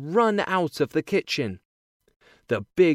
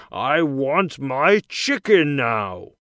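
loud, slow demand from the big man; giant's shout; 7 dB louder, slower than usual. A narrator's reading. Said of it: "he shouts".

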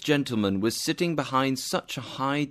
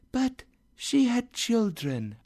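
high, floating otherworldly voice; ghostly spirit; 12 semitones higher than usual. Acted. Spirit reminds Scrooge of his sister's children, ghostly. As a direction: glide through it like an apparition.

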